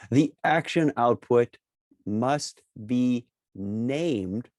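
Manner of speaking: each word set apart; slow, emphatic. Real, not acted. Presenter warning viewers of a pitfall, slow and emphatic. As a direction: spell out the rule word by word. Stressing a rule they once missed.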